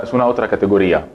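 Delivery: usual speaking voice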